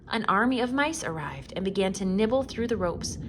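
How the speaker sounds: usual speaking voice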